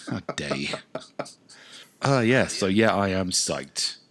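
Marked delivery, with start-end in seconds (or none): 0.1-0.7 mock offended whisper.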